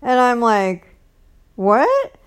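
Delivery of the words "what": high, louder incredulous question; surprised retelling. Retold or imitated. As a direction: act out being taken aback. Retells her startled reply to him.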